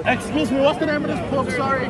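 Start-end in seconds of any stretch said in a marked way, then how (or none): none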